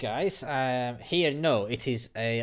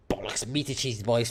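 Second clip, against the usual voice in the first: ordinary delivery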